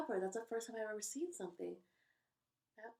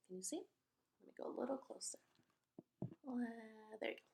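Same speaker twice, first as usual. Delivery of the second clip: whisper; 3 semitones higher, 9 dB quieter, much faster than usual. Real, not acted. Aside while moving the camera.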